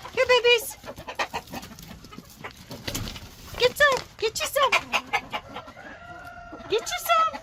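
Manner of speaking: high coaxing call to the hens; calling chickens. Real, not acted.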